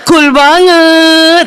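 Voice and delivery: hugely drawn-out admiration; gushing slang. Acted. Young people admiring a man's face, exaggeratedly drawn out. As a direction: stretch "banget" out very long.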